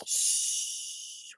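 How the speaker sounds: soft unvoiced shh; whispered shush. A narrator's reading. The little person hushing the family, whispered. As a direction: hush everyone quietly. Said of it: "whispered the little person".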